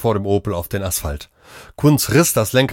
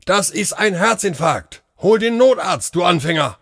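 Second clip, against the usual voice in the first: shouting; 9 semitones higher than usual. A narrator's reading. Doctor yelling at the robot to call help, shouting. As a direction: yell in alarm at the useless robot. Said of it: "schrie er".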